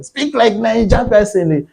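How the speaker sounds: high-pitched imitated telling-off; friends' reproach, imitated; mock scolding. Voicing friends scolding the speaker's accent.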